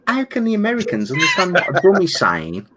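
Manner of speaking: high-pitched, incredulous mock question. Brummie wondering how Americans understand him, incredulous. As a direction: ask it high, in hammed-up disbelief. Acting out his own disbelief.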